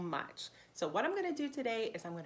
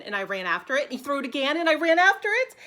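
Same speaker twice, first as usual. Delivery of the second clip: each chase louder and higher, from easy to thrilled; a dog getting more and more excited; building excitement; 4 semitones higher, 11 dB louder than usual. Acted. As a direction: rev it up with every throw. Dog chasing ball repeatedly.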